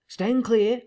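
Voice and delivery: lightly voiced intruder, high-pitched assent; former husky voice. A narrator's reading. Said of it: "said the intruder in the former voice".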